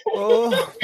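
gasping cough after laughing; choking on breath; coughing fit. Real, not acted. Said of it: "I think my lungs just tried to leave through my mouth".

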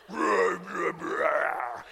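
low monster-like growled roars; voice from within her. Retold or imitated. Imitating wife pounding on the prankster, low roaring voice. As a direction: growl it like something possessed, mimicking her. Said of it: "this voice coming from within her".

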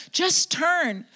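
JUST turn, high and firm; urging call. Real, not acted. Preacher urging listeners to turn, high firm call. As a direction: press the word just. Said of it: "repeated exhortation".

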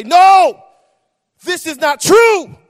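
shouted high and loud, slowed on the last words; booming divine shout; voice of the Lord. Retold or imitated. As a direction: thunder it like God's own voice. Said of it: "I heard the Lord of God say".

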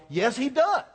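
ordinary delivery

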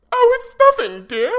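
high, loud flustered denial; nervous high wife voice. Acted. Wife caught hiding pin.

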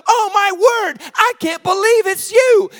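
high-pitched thrilled disbelief; overjoyed. Retold or imitated. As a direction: gush with acted amazement. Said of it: "she was so overjoyed".

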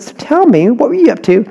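high, probing parent's question; suspicious parent. Retold or imitated. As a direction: corner the kid with a sly question.

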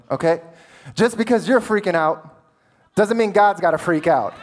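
ordinary talk

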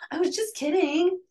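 defensive. Retold or imitated. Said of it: "naturally defensive".

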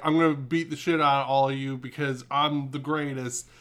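usual speaking voice

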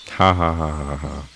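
loud laugh at own joke; ha ha at the punchline; forced laugh. Acted.

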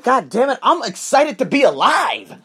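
high-pitched, exuberant outburst; high-energy excitement. Retold or imitated. Host answering a girl about his energy, excited outburst. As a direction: fire back with full, excited energy. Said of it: "do you always have this energy".

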